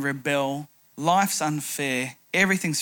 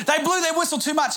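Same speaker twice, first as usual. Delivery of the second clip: higher, faster mock rant from an angry child; imitating a fuming son, quicker and higher; retold angry outburst; 9 semitones higher, faster than usual. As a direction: rattle it off like a furious kid. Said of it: "ropeable and full of rage".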